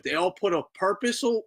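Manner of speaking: ordinary delivery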